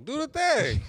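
high, loud mock urging; playful egging on. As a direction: cheer them on loudly. Co-host playfully urges on.

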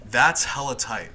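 a hint of a slacker movie line; Kyle. Shown as a demonstration. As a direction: read it out slowly for someone to copy. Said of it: "your best Kyle impression".

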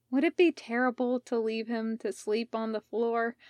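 usual speaking voice

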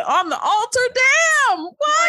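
high, loud comic outrage; scandalized shock. Acted. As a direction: build to a shrieked, laughing disbelief. Co-host joins scandalized reaction.

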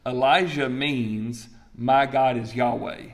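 slow, deliberate meaning; slow emphatic. Shown as a demonstration. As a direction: lay out each word slowly. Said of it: "Guess what his ministry was?".

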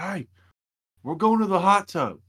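put-on a high, loud country-accent call to the hot tub. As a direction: hoot it like a country boy rounding up friends. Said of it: "in his little country accent".

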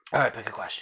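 unvoiced quiet whisper. Real, not acted. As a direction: whisper it to yourself. Whispered aside.

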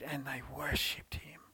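soft whispered awe; reverent whisper. Acted. Preacher whispering how the women worshipped at his feet. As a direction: hush it like kneeling in worship.

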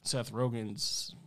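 ordinary delivery

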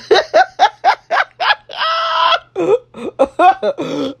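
high shrieking burst of laughter; real howl of laughter. Real, not acted. Laughs at own screensaver.

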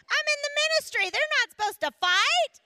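high, loud exasperated protest; comic outraged mom; mock indignation. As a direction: protest loudly, pitch shooting up. Comic indignation at fighting kids.

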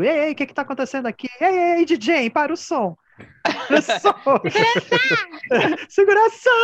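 high, loud frantic shouting with laughter breaking in; imitating friend yelling. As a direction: yell for the DJ and security like a panicked friend. Friend shouting at party, calling DJ.

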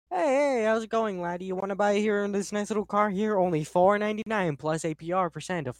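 put-on high, loud old-timey salesman; 1920s businessman voice. Shown as a demonstration. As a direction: pitch a car like a 1920s huckster. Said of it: "I do a bit of a nineteen twenties businessman".

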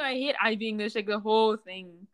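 usual speaking voice

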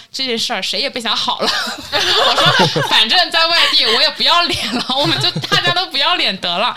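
laughing through her defiant threat; laughing mock threat. Retelling her threat at the debtor's door, laughing loudly.

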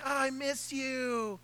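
soft slow teasing imitation of his wife; a terrible, softly pleading imitation of his wife; wife impression. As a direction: lightly mimic a wife missing her husband. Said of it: "terrible impersonation of her".

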